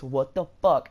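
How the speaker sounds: imitating a manager blowing up; retold outrage. Manager confronting the girl by name, loudly outraged. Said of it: "they were in a huge fight".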